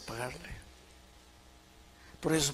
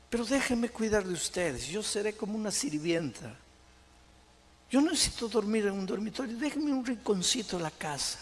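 high-pitched acted begging; imitated tearful plea; 8 semitones higher than usual. Wife begging husband and children to let her serve, acted pleading. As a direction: plead for a place, without real sobs. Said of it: "llorando les pedía perdón y les decía".

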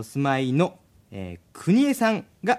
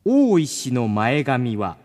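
very slow, weighty reading; slow dramatic reading; 5 semitones lower, slower than usual. Acted. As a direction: pause and drag the line out for effect. 投稿ネタをもったいぶって読む. (間をたっぷり取った重々しい読み)

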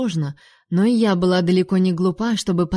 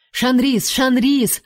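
repeated calls, raised and higher; shouting; 6 semitones higher, 3 dB louder, much slower than usual. A narrator's reading. Duke shouting her name from behind. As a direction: cry the name out twice. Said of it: "снова выкрикнул Небо".

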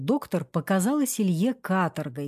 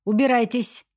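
higher-pitched order to get out; mild acted shout; 4 semitones higher, 3 dB louder than usual. A narrator's reading. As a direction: drive them off with a slightly raised voice. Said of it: "закричала она". (негромкий сердитый окрик)

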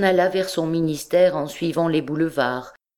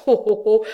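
higher, louder mock chuckle; laughing oh oh oh; 6 semitones higher, 4 dB louder than usual. A narrator's reading. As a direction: burst into a read-out laugh. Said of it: "un besoin de rire le saisit".

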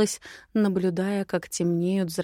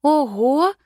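surprised gasp; 7 semitones higher, 6 dB louder than usual. Acted. Heroine gasps at stables.

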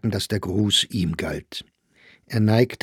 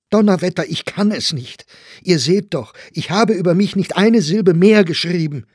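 loud, high, exasperated defence; angry protest; 9 semitones higher, 7 dB louder than usual. A narrator's reading. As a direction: protest heatedly against the accusation. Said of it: "Meyer wurde nun auch zornig". (zornig und laut protestierend)

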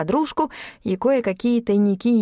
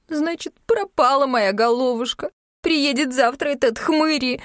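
higher-pitched mock wail; lamenting; 6 semitones higher than usual. A narrator's reading. Yulka tearfully bewails her fate to friends, lamenting. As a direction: lament your fate, lightly acted. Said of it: "запричитала".

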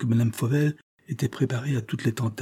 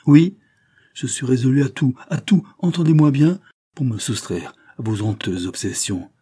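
loud and higher, driving to the end; resolute outburst; 5 semitones higher, 5 dB louder than usual. Acted. Madame Fauvel defying the marquis, loudly resolute. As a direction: fling her resolve at him.

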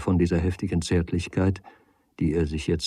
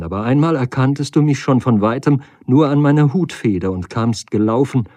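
raised, urgent lady's voice; agitated lady; 6 semitones higher, 9 dB louder than usual. Acted. Lady reminds Nagel how he ran to her, agitated. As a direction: press the old memory on him, higher and louder. Lady recalls past affection.

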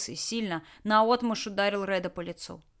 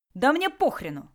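higher, louder bark; 6 semitones higher, 6 dB louder than usual. Acted. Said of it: "рявкнул Толян".

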